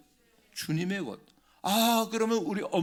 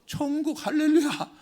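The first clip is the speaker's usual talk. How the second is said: much higher, ringing hallelujah; joyful exclaim; 11 semitones higher, 5 dB louder than usual. Real, not acted. Preacher answering his own question to the church, joyful exclaim. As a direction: cry it out in triumph.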